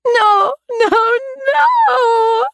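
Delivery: very high sobbing cry. A narrator's reading. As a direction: wail it through tears. Heroine sees stab wounds.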